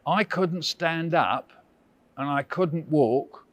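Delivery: normal talking voice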